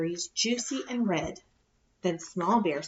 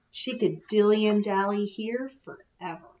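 plain reading voice

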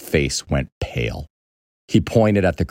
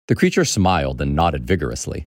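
plain reading voice

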